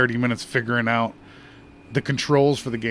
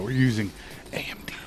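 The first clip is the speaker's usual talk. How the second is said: whispered aside; 2 semitones lower, 7 dB quieter, faster than usual. Real, not acted.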